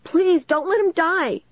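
slow, high-pitched begging; narrated shriek. A narrator's reading. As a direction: drag out a high, desperate plea. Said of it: "Caitlin shrieked".

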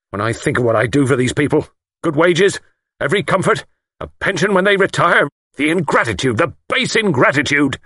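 indignant tirade, climbing high; choked with excitement. A narrator's reading. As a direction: work yourself up over the ingratitude. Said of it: "He choked with excitement".

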